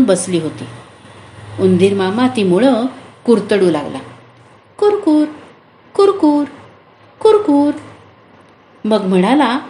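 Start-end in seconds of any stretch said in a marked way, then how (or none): 4.8-7.7 higher crunchy kurkur nibbling noise; gnawing sound. Acted. Mouse gnaws the radish roots underground, playful nibbling noise.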